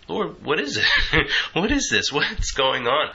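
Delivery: high, half-laughing baffled questions; bewildered. Retold or imitated. Speaker replaying her mind questioning the Lord while sobbing, bewildered.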